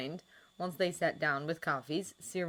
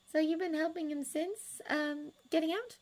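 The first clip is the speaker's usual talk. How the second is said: put-on higher, lighter voice for a shy young woman; Miss Hooper voice; 13 semitones higher than usual. A narrator's reading. Miss Hooper to Sirius, pitched up and hesitant.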